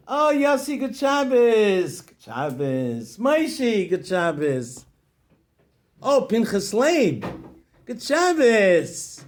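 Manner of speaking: high, loud, stretched-out happy greetings; glowing man greeting friends in shul; joyful greetings. Acted. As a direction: beam at each friend as you greet him.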